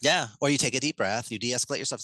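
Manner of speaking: ordinary talk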